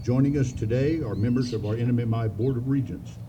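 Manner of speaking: ordinary talk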